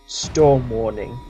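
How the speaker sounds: ordinary talk, nothing marked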